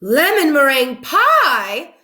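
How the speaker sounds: high, loud, slow delight; delighted exclaim. Acted. Mrs Rogers tastes the pie and exclaims, high and loud with delight. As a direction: savour it with delight. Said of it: "she exclaimed".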